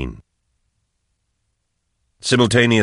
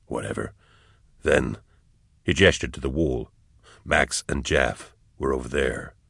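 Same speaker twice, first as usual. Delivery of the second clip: lower, soft-spoken calm soldier; quiet and level, explaining; a veteran calmly explaining to a shaken comrade; low steady soldier; 4 semitones lower, 6 dB quieter than usual. Acted.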